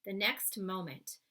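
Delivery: ordinary delivery, nothing marked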